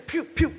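high, quick mimed lash noises; imitated whipping blows. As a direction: make the sound of a swung track striking.

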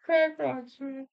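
squeaky high repeat of "Crack rocks"; high amused echo. Real, not acted. Echoing the punchline, amused.

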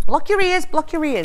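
high, loud "block your ears"; urgent warning. Real, not acted. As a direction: warn them fast before the noise. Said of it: "warning of a loud noise".

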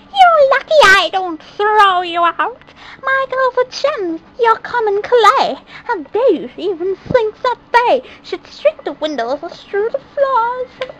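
high, harsh stepmother shout. A narrator's reading. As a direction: scold her like a cruel stepmother. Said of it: "Stepmother Screech began to shout".

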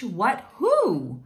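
ordinary delivery

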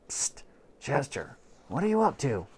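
soft hissed whisper; hushed aside to the cat. Acted. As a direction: whisper so the family can't hear.

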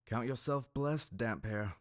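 mock his blessing; lightly voiced gruff captain. A narrator's reading. Stonehand mocking the priest, mild sarcasm.

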